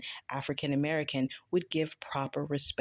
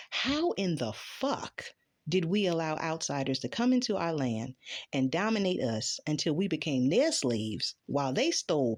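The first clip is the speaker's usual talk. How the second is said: ordinary talk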